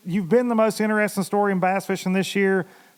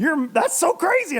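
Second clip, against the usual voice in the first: amazed guest; 10 semitones higher than usual. Retold or imitated. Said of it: "And he was like".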